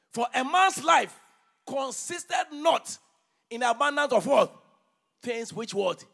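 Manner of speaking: loud, high, slow scripture reading from the pulpit; preaching at full force. Acted. Preacher reading Haggai aloud to his church, booming. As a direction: thunder the prophet's words over the congregation.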